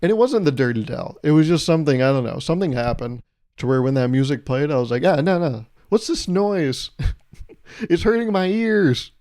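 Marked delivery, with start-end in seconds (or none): 5.0-9.1 mock pained whine. Reacting to drive-thru music.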